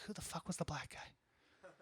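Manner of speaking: inner nagging thought. Retold or imitated. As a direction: muse it quietly in your head. Inner thought during the recap.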